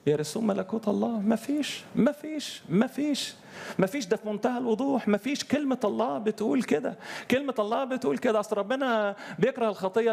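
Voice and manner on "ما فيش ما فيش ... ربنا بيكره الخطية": high, insistent preacher repeats ما فيش; impassioned preaching. Acted. Preacher insisting to his listeners, high impassioned preaching. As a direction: hammer the point home, pitched high.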